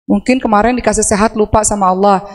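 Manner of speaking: usual speaking voice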